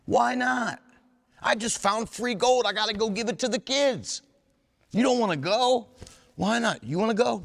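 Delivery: hammed-up excitement about giving free gold; mock eager. Sober man pressing friends to visit the prison, hammed-up high eager voice.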